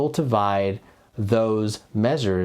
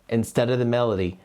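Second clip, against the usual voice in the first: usual speaking voice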